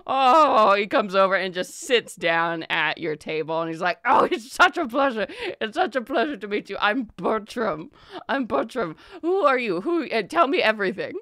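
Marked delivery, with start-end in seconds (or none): 4.0-11.1 louder, higher, gushing merchant. Acted. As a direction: gush over the newcomers. Merchant gushes at players.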